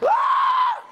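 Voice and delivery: loud, very high imitated baby scream; long piercing mock wail; newborn's scream. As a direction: scream like a newborn in full cry. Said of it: "That's exactly what she said".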